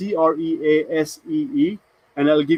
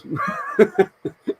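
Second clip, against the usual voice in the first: laugh. Real, not acted. Laughing at own joke.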